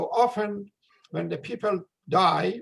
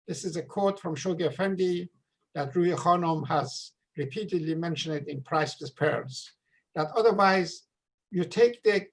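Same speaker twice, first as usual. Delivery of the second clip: ordinary delivery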